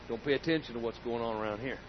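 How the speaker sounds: quiet, quick and calm; soft Jesus voice. Acted.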